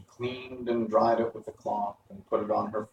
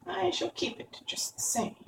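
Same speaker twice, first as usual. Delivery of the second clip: very high, softer, whispery witch voice; mocking witch; 7 dB quieter than usual. A narrator's reading. Witch keeps the shoe and mocks Dorothy, taunting. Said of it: "laughing at her".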